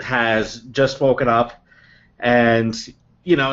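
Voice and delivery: ordinary talk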